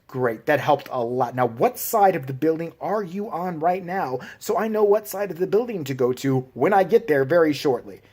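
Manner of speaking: usual speaking voice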